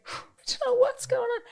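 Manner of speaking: high, squeaky mock mate; a high-voiced clueless classmate; mock baffled mate. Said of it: "your mate's like".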